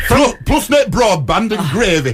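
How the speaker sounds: slow, broad Yorkshire advert voice; mock Yorkshire accent. Host spoofing a broadband advert, mock Yorkshire accent.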